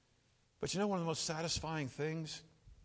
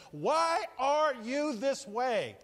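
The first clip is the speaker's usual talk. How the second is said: slow puzzled question; 6 semitones higher, 5 dB louder, much slower than usual. Pretend. Voicing puzzled onlookers.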